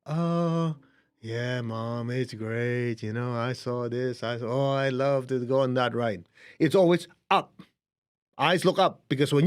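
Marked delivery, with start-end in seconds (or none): 0.0-6.3 put-on dreamy child. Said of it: "They actually go like this".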